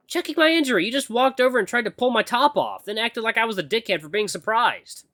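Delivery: high-pitched, indignant complaint; exasperated heroine. Acted. Acolyte scolding her moody mentor, exasperated.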